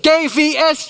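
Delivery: high, loud shout spelling out letters; yelling. Retold or imitated. To [name] who doesn't get it, a loud yell. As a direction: bellow it like someone at a post office counter. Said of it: "I started yelling like people do in the post office".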